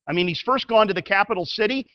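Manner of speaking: usual speaking voice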